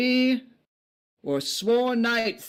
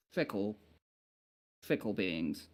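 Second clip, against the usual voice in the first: fickle repeated, dropping much softer and lower; fading mutter; 7 semitones lower, 11 dB quieter than usual. Acted. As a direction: let fickle fade out to almost nothing.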